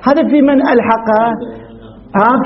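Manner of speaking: normal talking voice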